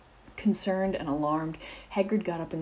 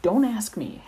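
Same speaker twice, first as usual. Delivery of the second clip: quick, higher, louder brush-off; exasperated; 6 semitones higher, 8 dB louder than usual. A narrator's reading. Hagrid to Pomfrey, exasperated and concerned. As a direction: toss it back, fed up. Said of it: "Hagrid said quickly, sounding exasperated and concerned".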